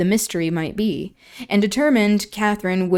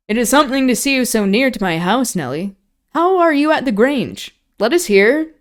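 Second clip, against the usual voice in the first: higher, louder, fast hearty greeting; false heartiness; 4 semitones higher, 5 dB louder than usual. A narrator's reading. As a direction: greet her with fake cheer. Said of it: "almost hearty tone".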